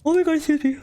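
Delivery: talking through a yawn; words stretched by a yawn. Real, not acted. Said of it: "I'm super yawny today".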